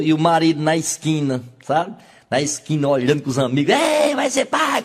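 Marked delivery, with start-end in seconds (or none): 3.8-4.8 high, slow gleeful call; celebrating husband. Acted. Husband on the corner with friends, high gleeful call. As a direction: shout the news to your drinking buddies.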